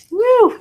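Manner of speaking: high, loud whoop of joy; excited whoop. Real, not acted. Thrilled by her own chills, loud high whoop. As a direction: let out a whoop of delight.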